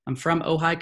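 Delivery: ordinary delivery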